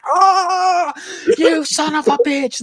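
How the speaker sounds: way up high, hammed-up exasperated curse; mock furious British merc. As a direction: overplay the rage with a laugh underneath. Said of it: "ridiculously hard ass he is at all moments".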